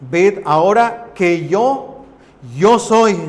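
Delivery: slow, loud, rising call on "yo soy"; thundering proclamation. Acted. Preacher booms God's words from Deuteronomy to the congregation. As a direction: boom it out slowly like a divine declaration.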